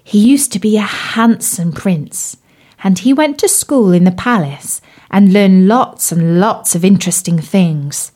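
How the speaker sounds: plain reading voice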